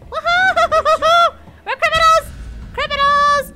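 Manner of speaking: high loud laughing cheer; gleeful shouting. Real, not acted. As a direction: shout with giddy delight.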